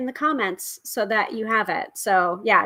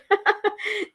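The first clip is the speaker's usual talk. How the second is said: bright laugh; 12 semitones higher than usual. Real, not acted. Laughs at her own plug.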